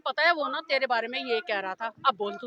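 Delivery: mimicked gossip. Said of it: "जैसे होता है ना कि जाके बोलते हैं". (धीमे सुर में चुगलखोर की हल्की नकल)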